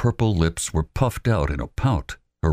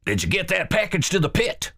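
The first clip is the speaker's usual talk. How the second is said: Wallace's voice. Acted. Wallace putting Albert in charge, commanding. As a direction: lay out the orders firmly.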